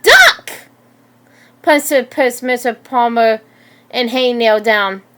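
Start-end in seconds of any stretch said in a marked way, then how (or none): 0.0-0.5 very high, loud yell; shouted warning. A narrator's reading. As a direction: shout an urgent warning to take cover. Warning as fire bursts out.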